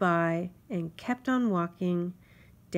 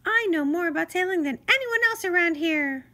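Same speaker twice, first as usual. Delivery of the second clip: high, louder self-important insect voice; boastful mosquito; 11 semitones higher, 7 dB louder than usual. Acted. Mosquito boasts to Grasshopper, high and proud. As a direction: brag like a tiny sea captain.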